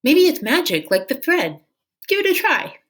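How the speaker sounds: put-on high, hopeful girl voice; Minley urging dragon to try; girl's voice. A narrator's reading. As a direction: coax him gently upward. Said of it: "Minley said".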